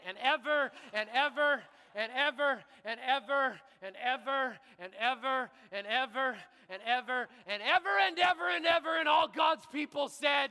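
building preaching shout. Real, not acted. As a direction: hammer and ever again and again, rising to a shout. Preacher climaxes into call for amen.

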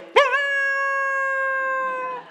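one long, very high mock howl; imitated dog howl. Puppy banished far downstairs, howling long and high. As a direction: hold a piercingly high puppy wail.

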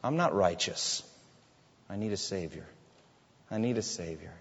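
low-key humbled plea; humble inner confession. Acted. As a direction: quietly confess you can't make it alone. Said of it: "look inward and say".

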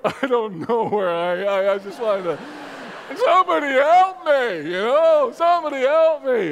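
building to a high, loud cry for help; hammed-up panic, rising into a yell; mock desperate plea. Lost traveller calling out to strangers at the station, mock desperate.

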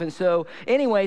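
normal talking voice

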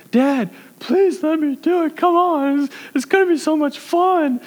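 put-on very high, slow, begging kid's whine; high-pitched childish please, please; whiny pleading child. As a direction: wheedle like a small boy begging his dad. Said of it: "that's an accurate representation".

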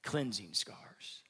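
soft, slow, near whispered; hushed reverence. Acted. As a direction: drop to a reverent hush. Hushed closing.

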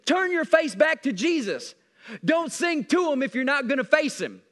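emphatic, driving sermon delivery; full-force preaching. Acted. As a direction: preach it hard to the congregation.

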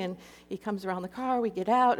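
usual speaking voice

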